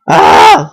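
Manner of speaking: short loud frustrated yell of ah; stressed-out cry. Retold or imitated. Stressed couple at the pizza place, frustrated cry. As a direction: let out a mock yell of frustration. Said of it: "Like, pulling our hair out".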